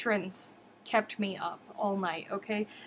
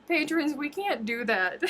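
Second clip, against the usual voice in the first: higher, breaking into laughter; laughing plea; 7 semitones higher than usual. Real, not acted. Streamer begging patrons off late nights, laughing. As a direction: plead with them through laughter.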